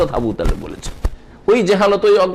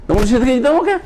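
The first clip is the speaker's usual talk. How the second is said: incredulous question; 8 semitones higher, faster than usual. Acted.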